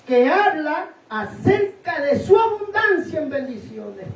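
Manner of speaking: high, slow declamation with stretched words; emphatic preaching. Real, not acted. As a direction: proclaim it to the congregation, high and drawn out. Predica con fuerza. (predicación enfática, aguda y pausada)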